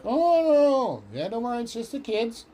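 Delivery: put-on a caught adult squeaking that it's only the kids; 1 mock high adult. Adults hiding the powder.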